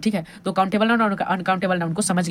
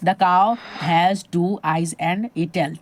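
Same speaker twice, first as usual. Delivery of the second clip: slow dictation; much slower than usual. Shown as a demonstration. Teacher reading example sentence aloud.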